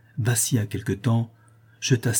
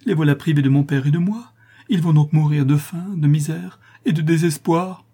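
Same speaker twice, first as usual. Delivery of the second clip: raised, high-pitched despair; anguished exclaiming; 7 semitones higher, 6 dB louder than usual. A narrator's reading. Daughter foresees her family starving, anguished cry. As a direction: build in distress to the end. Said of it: "s'écria Louise".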